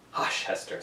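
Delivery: hushed urgent whisper. Acted. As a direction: breathe it to quiet her. Dimmesdale hushes Hester.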